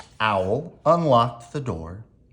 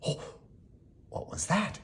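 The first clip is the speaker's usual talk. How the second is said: hushed frightened whisper; 6 semitones higher, 10 dB quieter, faster than usual. Acted. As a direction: breathe it out in fear. Scared question in the dark.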